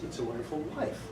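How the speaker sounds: soft, rising "It's a wonderful life" with the weight on "life"; modern stress. Shown as a demonstration. Narrator showing the modern inflection to students, soft and rising. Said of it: "in modern usage".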